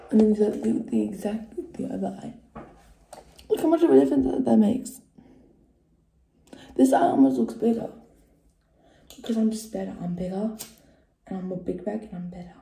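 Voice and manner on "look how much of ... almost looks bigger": higher and louder, proud; excited. Real, not acted. Vlogger showing viewers her curled lashes, excited and loud. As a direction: show it off with delight.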